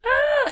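put-on high loud child's scream; piercing kid's cry; child's wail. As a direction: shriek like a toddler wanting to be carried.

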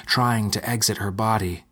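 ordinary delivery

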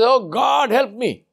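high, pleading cry for help; desperate scream to God. Acted. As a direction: call out to God in sheer panic. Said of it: "So he screams".

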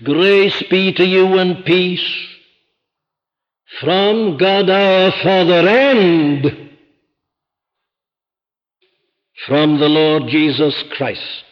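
each phrase slow and weighty; solemn slow reading of the greeting; slow scripture reading. Shown as a demonstration. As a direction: read the verse slowly, letting it sink in.

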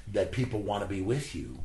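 ordinary delivery

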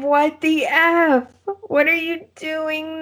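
high, stretched-out mock disbelief; shocked disbelief. Viewer retelling what she yelled at the character, shocked. Retold shocked reaction to knife.

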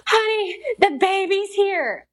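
high, stretched-out excited announcement; retold excited cry, high and drawn out; urgent wake-up. Retold waking husband in a panic.

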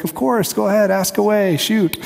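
mock gracious neighbor saying yes; kind Kansas neighbor. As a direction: neighbor politely agreeing to questions, lightly voiced. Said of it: "in their Kansas City kindness".

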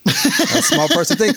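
high, slow, laughing put-on voice; hammy fortune teller squeak; mock mystic voice.

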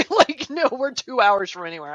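laughing at the idea. Retold or imitated. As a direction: laugh through the reply. Laughing retold reply.

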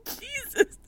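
ordinary delivery

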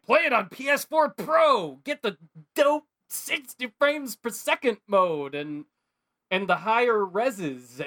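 high, excited marketing pitch; hype ad voice. Retold or imitated. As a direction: sell it like an overeager commercial. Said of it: "the messaging around Knack two is all like".

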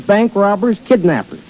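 usual speaking voice

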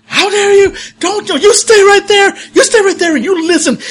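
high, loud scolding tirade; furious from behind a door. Acted. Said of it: "you hear from inside the door".

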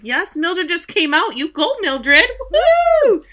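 high, building whoop of support; excited cheering. Retold or imitated. Viewer cheering Mildred for coming out, excited. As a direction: cheer her on, rising to a loud woo.

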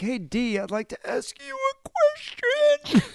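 high, strained, running out of air; breathless executive voice. Retold or imitated. A VP talking to Kate, breathless and high. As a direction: squeeze the words out on no breath. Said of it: "he has to force out the words".

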